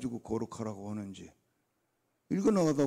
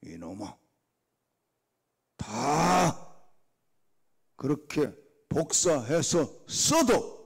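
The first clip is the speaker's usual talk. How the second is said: voice of God; 3 semitones higher, 8 dB louder, slower than usual. Retold or imitated. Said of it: "하나님 뭐라고 하실 것 같아".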